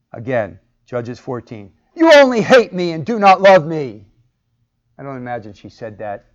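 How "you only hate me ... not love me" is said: loud, higher tearful accusation; with emotion and volume. Retold or imitated. Samson's wife accusing him, loud and hurt. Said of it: "injected some emotion".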